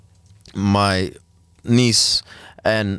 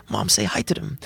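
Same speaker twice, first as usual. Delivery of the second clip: put-on higher and softer, like an eager little girl; childlike bright; 4 semitones higher, 5 dB quieter than usual. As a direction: let the inner child shine through, softly. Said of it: "her inner child comes through so brightly".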